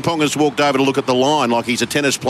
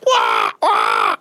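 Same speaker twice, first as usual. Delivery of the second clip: macaw call. Pretend. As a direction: shout it like a macaw. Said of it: "Do it again".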